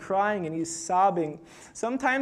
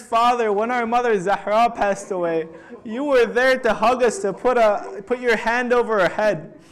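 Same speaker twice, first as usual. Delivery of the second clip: louder, anguished plea of a son to his father; impassioned grieving plea; 3 semitones higher, 6 dB louder than usual. Retold or imitated. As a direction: plead loudly through grief, as a retold lament. Grieving son pleads with dying father.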